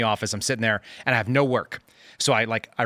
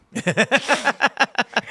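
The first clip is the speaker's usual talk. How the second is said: high, louder burst of laughter; 12 semitones higher, 4 dB louder than usual. Real, not acted. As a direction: crack up at your own joke. Laughs at own punchline.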